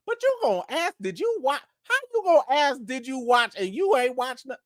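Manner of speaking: high, incredulous questioning; mock indignation. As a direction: playfully call out the nerve of it. Mock scolding of a viewer.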